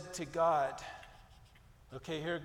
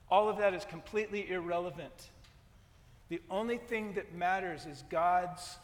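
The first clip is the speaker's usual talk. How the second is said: ordinary delivery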